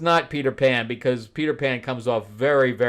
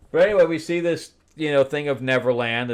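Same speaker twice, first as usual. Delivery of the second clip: usual speaking voice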